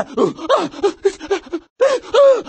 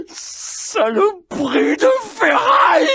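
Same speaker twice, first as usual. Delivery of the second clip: furious curse; 10 semitones higher than usual. Acted. Cursing a machine.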